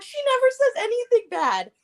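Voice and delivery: high-pitched, re-enacted astonishment; amazed disbelief. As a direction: act out being stunned, voice way up. Acting out own amazement.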